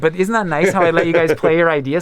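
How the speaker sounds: dripping false graciousness to the band; mock condescending. His younger self to bandmates, smugly condescending. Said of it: "they saw my face when it was like".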